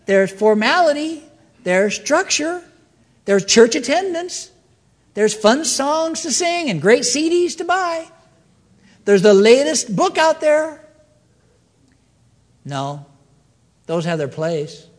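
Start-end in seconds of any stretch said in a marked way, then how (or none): 0.1-10.8 high, rising sales-pitch list; mock enthusiasm. Preacher lists what Jesus doesn't offer, mock enthusiasm.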